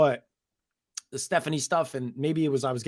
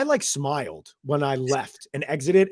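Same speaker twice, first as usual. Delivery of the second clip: ordinary delivery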